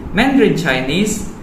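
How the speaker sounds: ordinary talk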